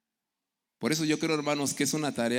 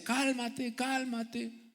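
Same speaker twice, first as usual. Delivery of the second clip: high-pitched calming plea, much higher; mock calming wife; 12 semitones higher, much slower than usual. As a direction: play the wife begging him to calm down. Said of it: "decía".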